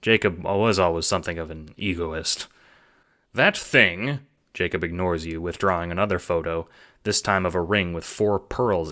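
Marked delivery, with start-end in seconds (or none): 3.3-4.2 higher, louder, fast-talking pitch; eager schemer. Acted. Jacob pitching the assassination job, eager and hustling. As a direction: sell the plan like a hustler.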